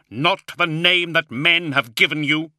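high, insistent shout; forceful demand. A narrator's reading. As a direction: demand it of a defiant demon. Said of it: "he demanded".

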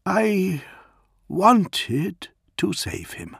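halting emotion. A narrator's reading. Dantioch pauses, emotional.

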